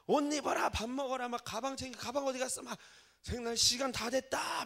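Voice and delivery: a harried dad barking orders to hurry; father rushing his four kids out the door, loudly; hurried yelling at kids. Retold or imitated.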